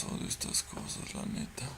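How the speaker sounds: soft whisper. Real, not acted. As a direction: whisper it so nothing hears you. Scared player whispering.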